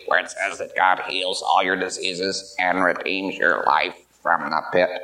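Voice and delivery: low, slow, raspy reading of scripture. Retold or imitated. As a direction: imitate the man straining through the Psalm. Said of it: "in this real raspy voice".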